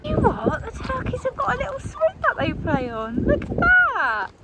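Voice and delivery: very high, thrilled squeal; delighted gushing. Real, not acted. Visitor spotting the turkeys' swing, high and delighted. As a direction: marvel in a high, excited voice.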